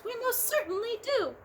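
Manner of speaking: mayor of the speck, grateful; tiny Who voice. A narrator's reading. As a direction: speak as a tiny grateful person. Voice on the dust speck.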